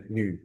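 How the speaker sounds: short "niu" with the i sound rounded; rounded mouth. Shown as a demonstration. Teacher wrapping up the correction, "niu" said with a round mouth. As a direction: round the lips on the vowel of "niu". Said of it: "Mulutnya bulat ya".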